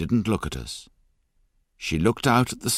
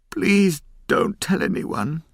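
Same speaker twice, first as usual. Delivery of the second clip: high, slow anguished begging, much higher; crying plea; 11 semitones higher, 4 dB louder, slower than usual. A narrator's reading. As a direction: beg slowly, voice rising in distress. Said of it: "she cried".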